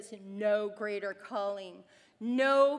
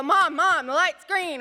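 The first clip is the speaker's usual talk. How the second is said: higher, louder urgent calls; kids calling; 5 semitones higher, 9 dB louder than usual. Retold or imitated. Kids waking napping mom at the light, calling loudly. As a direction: wake mom up with insistent calls. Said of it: "And they go".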